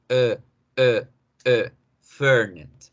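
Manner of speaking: isolated er, then a drawn-out Fernand; English er sound. Shown as a demonstration. Teacher drills the vowel, English er into Fernand. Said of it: "En inglés".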